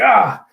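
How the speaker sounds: high loud yelp of pain; pained cry. Retold or imitated. Fighter hit by a blast, yelping in pain.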